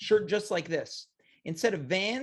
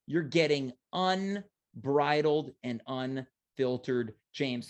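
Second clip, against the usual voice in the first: dramatic announcer; 5 semitones lower, much slower than usual. Acted. Hyped self-introduction.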